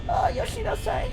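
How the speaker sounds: lightly faked Japanese name; fake Japanese. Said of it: "Fake Japanese".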